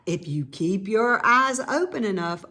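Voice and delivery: ordinary delivery, nothing marked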